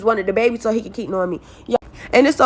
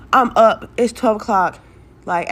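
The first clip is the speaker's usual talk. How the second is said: ordinary delivery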